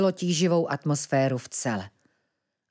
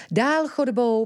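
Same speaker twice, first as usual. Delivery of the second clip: ordinary delivery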